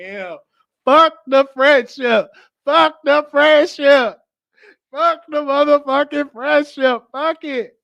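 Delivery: high, slow, drawn-out yelling; hammed-up protest, stretching every word; frustrated outburst. Rejected man venting about the friendship, frustrated outburst.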